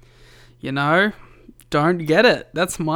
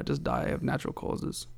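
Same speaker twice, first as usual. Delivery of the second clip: deadpan whisper; 10 dB quieter than usual. Pretend. Deadpan aside.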